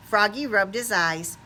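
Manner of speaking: ordinary delivery, nothing marked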